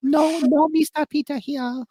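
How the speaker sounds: high, slow put-on maid voice; squeaky hammed-up maid; housekeeper voice. Mock housekeeper voice.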